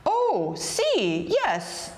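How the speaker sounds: high, drawn-out eager agreement dropping at the end; eager young helper. A narrator's reading. Big Anthony promises his employer to obey, eagerly. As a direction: agree too readily, swooping down from high.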